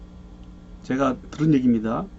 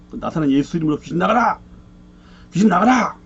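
shouted exorcism command; 4 semitones higher than usual. Retold or imitated. Evangelist commanding the demon to leave, rising shout. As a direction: bark the command at the demon, building.

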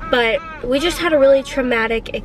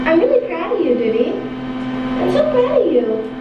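proud cooing; 6 semitones higher than usual. Real, not acted. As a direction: coo it out, beaming with pride. Mother praising toddler at scan.

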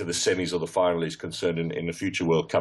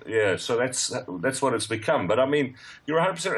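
usual speaking voice

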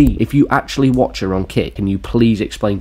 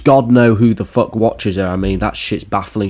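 normal talking voice

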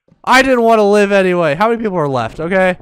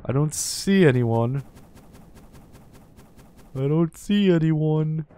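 ordinary talk, nothing marked

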